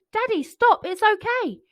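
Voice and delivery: put-on high, loud pleading child's call; child shouting. Boy begging his father to spare the bear, high loud shout. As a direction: shout to stop him, urgent. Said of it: "he shouted".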